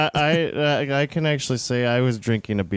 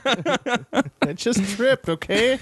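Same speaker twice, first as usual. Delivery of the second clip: laughing protest; 10 semitones higher, much slower than usual. Real, not acted. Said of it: "Stop being so mean".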